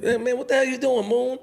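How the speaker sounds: high startled call-out; surprised shout at Moon. Retold or imitated. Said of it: "being surprised".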